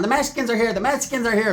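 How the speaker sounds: lightly voiced jittery little man; high alarm. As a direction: call it out like a nervous lookout. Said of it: "Dwarfwood's still running around".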